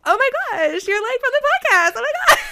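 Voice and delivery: a church volunteer mimicking the young fan who recognized her, gushing and high; starstruck fan. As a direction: squeal it like a fan meeting her idol. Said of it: "her face just, like, lit up and she's like".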